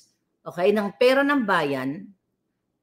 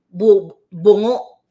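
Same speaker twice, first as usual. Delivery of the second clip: louder, furious burst on bungo; angry yell; 9 dB louder, much slower than usual. Real, not acted. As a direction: spit out the word bungo. Ranting insult at the mayors. (galit na sigaw)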